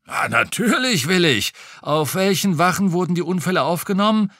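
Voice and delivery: a hint of a gossiping retired policeman; old policeman voice. A narrator's reading. Retired cop chatting to the detective over lunch, lightly voiced.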